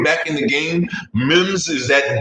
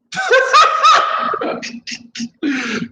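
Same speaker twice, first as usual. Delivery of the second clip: laughing. Real, not acted. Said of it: "I like laughing at myself".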